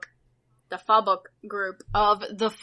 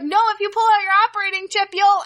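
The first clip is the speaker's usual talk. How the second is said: mock warning; 9 semitones higher, 4 dB louder, much faster than usual. Voicing the game's warning, cut off.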